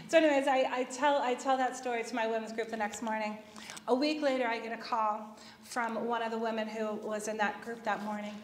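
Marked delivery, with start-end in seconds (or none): none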